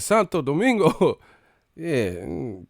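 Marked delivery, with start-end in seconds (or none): 0.0-1.2 high, loud drawn-up call; imitated Dominican hype cry; mock Dominican cheer. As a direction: shout out the homeland in a high voice. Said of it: "He said".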